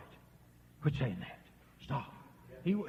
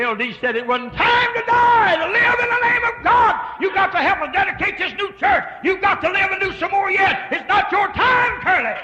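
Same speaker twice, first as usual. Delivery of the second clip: preached command; 14 dB louder than usual. Retold or imitated. Preacher relaying the message to the dying man, shouting. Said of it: "whisper in his ear and say".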